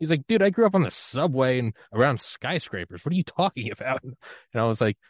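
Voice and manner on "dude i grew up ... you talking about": high, louder retold outburst; incredulous protest. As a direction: imitate an exasperated friend setting you straight. Retold friend protesting in disbelief.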